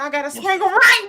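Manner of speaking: urgent exclaim. Retold or imitated. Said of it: "she said".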